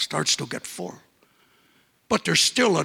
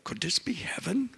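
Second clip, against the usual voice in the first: soft breathless whisper of awe; whispered wonder; 9 dB quieter than usual. Acted. Dubois wondering to himself in a new place, whispered in awe. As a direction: breathe it as a private thought. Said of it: "he thought to himself".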